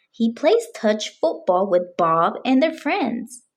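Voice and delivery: usual speaking voice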